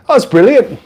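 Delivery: put-on English accent. Streamer retests the English announcer accent for chat, slightly Irish. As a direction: exclaim it in a wobbly English accent. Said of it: "it does sound kinda Irish".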